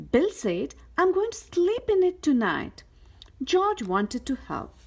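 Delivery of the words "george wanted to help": usual speaking voice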